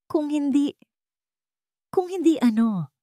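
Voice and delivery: high, shaking question in fear; trembling question. A narrator's reading. Ara to the blackmailer on the phone, trembling and high. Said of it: "Nanginginig na tanong ni Ara".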